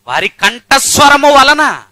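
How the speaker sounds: slow, loud, high proclamation; drawn-out preacher's roar; booming preaching. Acted. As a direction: thunder it out from the pulpit. Preacher booming scripture phrase.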